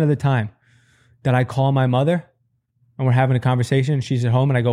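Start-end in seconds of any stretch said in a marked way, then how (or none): none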